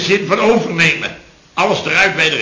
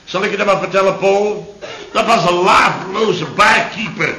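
ordinary talk, nothing marked

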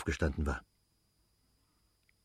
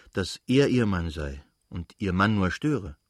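ordinary delivery, nothing marked

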